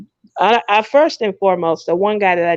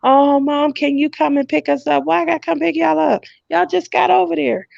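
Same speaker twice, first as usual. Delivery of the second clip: put-on higher, squabbling kid voices going back and forth; mock kids arguing; 7 semitones higher than usual. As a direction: play both kids bickering, rising as it goes. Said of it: "They're arguing".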